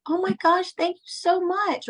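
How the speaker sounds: high, slow, gushing thank-you; delighted thanks. Retold or imitated. Flight attendant thanking the passenger for her gift, high and gushing. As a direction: gush with surprised gratitude.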